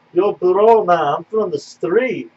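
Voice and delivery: put-on slow street talk in a Russian accent; lightly voiced Russian street accent. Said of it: "he had that thick Russian accent".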